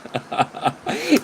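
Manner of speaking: real amused laugh; a hearty laugh; laughter. Real, not acted. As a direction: burst out laughing at the clip. Laughing at the clip.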